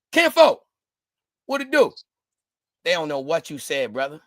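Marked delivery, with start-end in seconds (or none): 0.1-1.9 higher and louder, baffled repeat; puzzled echo. Retold or imitated. Answering a stranger abroad, baffled mock reply. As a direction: throw it back confused.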